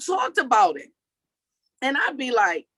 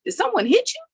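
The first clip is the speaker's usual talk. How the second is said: higher, surprised questions about the mark; startled friend; 6 semitones higher than usual. Retold or imitated. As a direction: play a friend suddenly spotting the birthmark. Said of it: "And then one day they're like".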